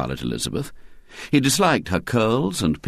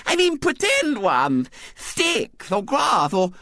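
put-on high impatient little girl; piping small-girl explanation; Violet Elizabeth; 13 semitones higher, slower than usual. A narrator's reading. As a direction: correct him primly with a childish lisp. Little girl explains her pretend game.

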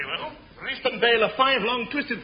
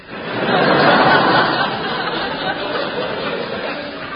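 wheezy laugh; 7 semitones higher than usual. Acted. Laughing at the Air Ministry.

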